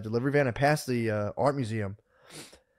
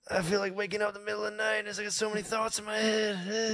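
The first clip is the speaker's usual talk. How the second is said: higher, softer fake-modest guy; mock humble worker; 8 semitones higher, 6 dB quieter than usual. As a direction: play the humble working man. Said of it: "I was like".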